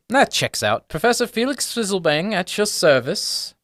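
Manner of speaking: satisfied, then grand high-pitched introduction; formal, lilting little academic; gnome professor. Acted. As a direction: present yourself with professorly flourish. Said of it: "he looks and he goes".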